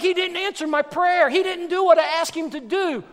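high, loud griping; frustrated complaint. Acted. Preacher voicing people whose prayers went unanswered, frustrated. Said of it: "And then we get frustrated".

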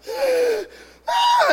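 high-pitched imitated wail; mock crying scream. Imitating a woman told her groceries are paid, mock wail. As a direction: squeal like someone bursting into tears. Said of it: "she just started screaming, crying".

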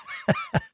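soft high giggle; chuckle. Real, not acted. Host amused after mock scolding, soft giggle. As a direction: let a small giggle slip out.

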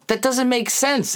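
bewildered mock wife; confused disbelief.

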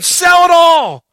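slow, loud, stretched command; rich young ruler. Acted. Preacher voicing the rich young ruler, slow loud command. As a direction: boom it out slowly like a stern order. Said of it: "the rich young ruler saying".

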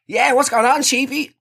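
high, eager bro greeting; trying too hard to sound like a lad; matey bro voice. Retold or imitated. Greeting the dish installer, overly matey.

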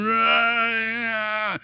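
put-on whiny wordless toddler groan; imitated child whine.

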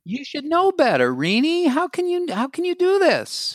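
high, scolding critical voice; critical inner voice. Shown as a demonstration. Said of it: "give me a one-liner".

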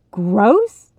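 loud, rising, appalled question; aghast; horrified disbelief. A narrator's reading. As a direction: echo it in horror. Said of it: "stare in abject horror".

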